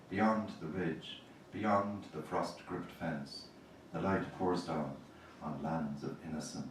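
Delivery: measured, deliberate poem reading with the line breaks held; poetry reading. Acted. Poet reading her poem to a live audience, measured and deliberate. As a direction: read each line out slowly, letting it land.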